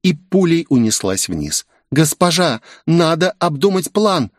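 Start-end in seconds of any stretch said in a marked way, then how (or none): 1.9-4.3 higher-pitched, urgent plea; acted worried maid calling after her mistress; calling after someone. A narrator's reading. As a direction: call out in a raised pitch to stop her. Maid calls after departing mistress.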